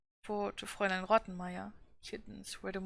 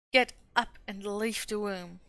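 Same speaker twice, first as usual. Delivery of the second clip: slow, louder furious command; angry order; 6 dB louder than usual. A narrator's reading. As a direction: order her out, slowly and hard. Governess furious at Heidi.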